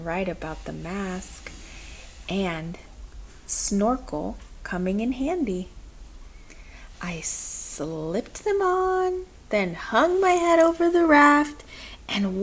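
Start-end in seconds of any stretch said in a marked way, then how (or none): none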